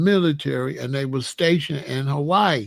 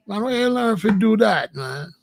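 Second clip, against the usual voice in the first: higher-pitched Caribbean bro voice; imitated Caribbean accent; 5 semitones higher than usual. Caribbean bros questioning his DJing, imitated island accent. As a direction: ask in an animated Caribbean accent. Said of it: "the Caribbean bros like".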